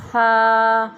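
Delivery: سا with the tongue between the teeth, soft, no whistle; gentle سا, tongue slightly out; soft tha. Shown as a demonstration. Teacher showing children a soft سا with no hiss. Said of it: "سیٹی کی آواز بالکل نہ آئے".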